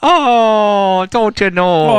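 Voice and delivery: mock Upper Midwest girl; northern Wisconsin accent. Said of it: "They sounded like Canadians".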